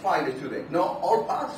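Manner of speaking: usual speaking voice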